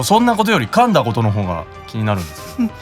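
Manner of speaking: ordinary talk, nothing marked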